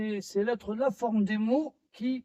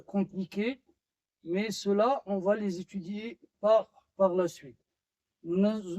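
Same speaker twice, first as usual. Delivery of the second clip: normal talking voice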